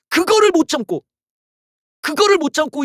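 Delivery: high, loud exasperated cry; angry outburst. Real, not acted. Ranting YouTuber bursting out in anger at Yoon. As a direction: blurt it out in frustration. Furious rant peak.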